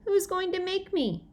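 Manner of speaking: high defiant yell. A narrator's reading. Pete yelling at Sheila, high defiant yell. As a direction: yell the challenge back. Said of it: "I yelled".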